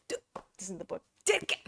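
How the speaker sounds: usual speaking voice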